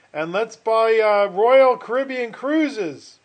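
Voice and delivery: hammed-up enthusiasm, stretching the name; mock eager investors. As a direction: imitate club members pushing a bold buy. Imitates eager investment club members.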